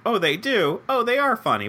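high-pitched mock delighted discovery; lilting, acted "oh!" of approval; pleasantly surprised. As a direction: act out an employer happily finding out. Acts out employers checking a candidate.

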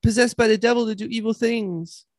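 put-on high-pitched kid's rush of explanation; earnest, hurried child voice; eager child. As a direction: gabble it out like an excited boy. Boy explains surprise to mother.

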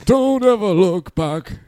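light sing-song delivery; sing-songy. Acted. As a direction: lilt it like a line from Batman. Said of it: "In a sing-songy voice like that?".

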